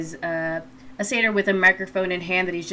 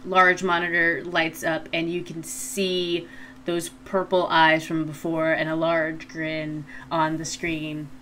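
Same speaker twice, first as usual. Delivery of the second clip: usual speaking voice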